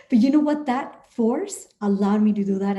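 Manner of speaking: normal talking voice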